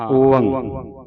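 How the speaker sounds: slow ਓੰਗ as "o-ang"; open o, nasal ending. Shown as a demonstration. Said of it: "ਊੜੇ ਦਾ ਮੂੰਹ ਖੁੱਲ੍ਹਾ".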